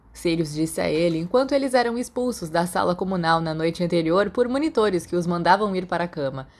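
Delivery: ordinary delivery, nothing marked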